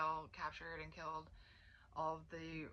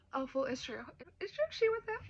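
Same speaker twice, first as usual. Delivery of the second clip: much higher and louder, squeaky mock voice; mocking Dane; 8 semitones higher, 8 dB louder than usual. Mocking Dane's reaction to the rumors being true, squeaky and shrill. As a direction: lampoon his hurt outrage.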